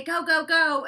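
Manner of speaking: urgent order. Retold or imitated. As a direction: hurry them along loudly. Acting out a hurried order to leave.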